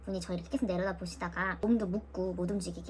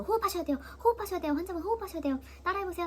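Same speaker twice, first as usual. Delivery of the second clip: much higher, insistent nurse voice; nurse urging; 9 semitones higher, faster than usual. Retold or imitated. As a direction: coax the patient like a brisk nurse. Nurse urging breathing practice.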